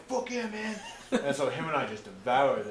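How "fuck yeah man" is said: high-pitched eager cheer; retold delight. Hungry buddies seeing the rice arrive, retold excited cheer. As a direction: celebrate in a much higher voice.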